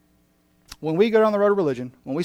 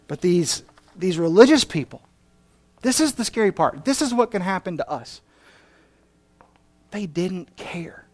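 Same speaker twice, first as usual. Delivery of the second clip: ordinary talk, nothing marked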